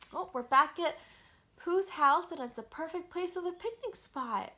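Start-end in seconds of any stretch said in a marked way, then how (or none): none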